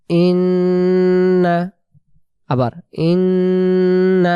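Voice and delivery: slow chanted recitation; drawn-out Quranic chant. Shown as a demonstration. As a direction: recite slowly and melodically. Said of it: "তারপরে শা".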